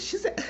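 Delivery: high-pitched imitated cackle of laughter. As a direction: mimic her giggle high and light. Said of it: "she was laughing".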